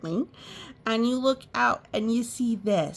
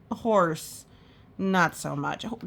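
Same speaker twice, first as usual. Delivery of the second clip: usual speaking voice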